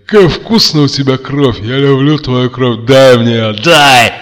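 громкий высокий одержимый голос; требуй крови, доходя до крика; кровожадное безумие. A narrator's reading. (одержимый кровожадный крик)

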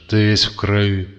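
хрипло выговорить; хриплый голос с нажимом. A narrator's reading. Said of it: "прохрипел Гриша". (прохрипеть)

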